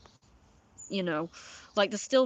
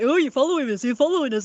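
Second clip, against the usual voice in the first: loud, higher mock of their accusing voice; hammed-up whiny taunt; mocking imitation; 5 semitones higher, 8 dB louder, faster than usual. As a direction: parrot them back, loud and high, ridiculing. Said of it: "they're like".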